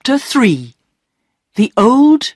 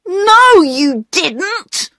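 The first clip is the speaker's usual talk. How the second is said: put-on high-pitched boy's cross contradiction; angry denial; 13 semitones higher, much slower than usual. A narrator's reading. Peter contradicting his sister before the station master, angry denial. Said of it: "said Peter angrily".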